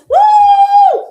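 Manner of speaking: very high, loud shouted whoop; cheerleader whoop. Shown as a demonstration. Coach showing her extrovert energy, whooping loudly. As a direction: let out a big cheer. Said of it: "I demonstrate like this".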